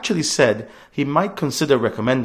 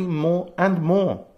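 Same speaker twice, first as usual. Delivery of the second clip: "more and more" drawn out very slowly; slow, ominous stress on "more and more"; slow emphasis; 4 semitones higher, much slower than usual. Real, not acted. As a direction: stretch "more and more" to show it piling up. Campaign speech, climactic phrase.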